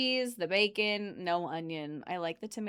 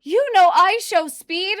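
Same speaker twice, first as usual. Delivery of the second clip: put-on much higher, louder, slower eager child; kid's voice; 12 semitones higher, 16 dB louder, slower than usual. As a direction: play the excited little kid asking. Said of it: "And he's like".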